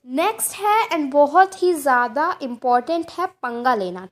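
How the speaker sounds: normal talking voice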